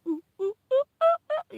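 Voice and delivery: high, loud monkey hoots; whooping monkey-style "ooh"s; monkey cry. Retold or imitated. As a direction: imitate a crying monkey with rising hoots. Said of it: "he cries like a monkey".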